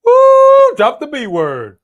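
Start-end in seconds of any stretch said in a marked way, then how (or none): 0.0-0.7 a huge high Woo at full volume; Flair-style woo. Real, not acted. Watching wrestling with a friend, letting out a big Woo. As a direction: yell it like a ringside fan. Excited wrestling fan cheer.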